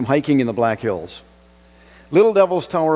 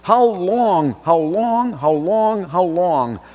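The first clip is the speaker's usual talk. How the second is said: higher, pressing repeated question that winds down; impatient repetition; 4 semitones higher, slower than usual. Acted. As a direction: keep pushing the question, then let it sag. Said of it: "You are waiting for something to end".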